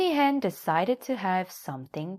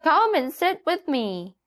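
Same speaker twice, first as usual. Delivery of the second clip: high, louder hen voice; a bright, clucking hen; Nanny Hen; 11 semitones higher, 5 dB louder than usual. A narrator's reading. Hen greets the little donkey in a high voice. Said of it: "said Nanny Hen".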